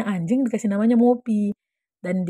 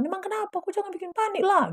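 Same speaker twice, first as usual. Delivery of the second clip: high, softer anxious protest; retold panic; 12 semitones higher, 3 dB quieter than usual. Rider scolding the panicking friend, high worried retelling. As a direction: plead in mock alarm. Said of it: "Aku panik juga lah kan".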